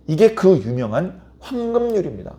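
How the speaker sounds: ordinary talk, nothing marked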